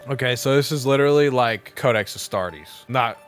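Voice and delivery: ordinary talk, nothing marked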